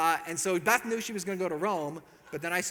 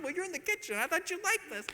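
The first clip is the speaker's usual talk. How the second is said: high, light clueless husband voice; mock innocent self; 14 semitones higher than usual. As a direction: play your past self, naively proud.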